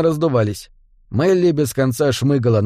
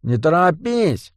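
high, slow street drawl; a hint of a swaggering goblin; cocky goblin calling from behind; lightly voiced goblin gangster; 4 semitones higher, slower than usual. A narrator's reading. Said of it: "гнусавый голос".

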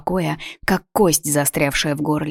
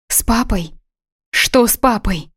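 frightened; 6 semitones higher than usual. Acted. Daughter frightened about father.

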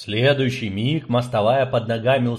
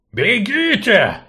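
slow, loud barking dwarf; gruff dwarf bark; 9 semitones higher, 5 dB louder, much slower than usual. Acted. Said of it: "рявкнул гном девчонкам".

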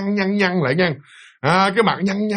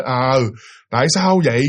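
usual speaking voice

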